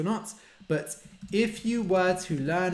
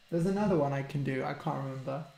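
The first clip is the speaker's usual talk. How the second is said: normal talking voice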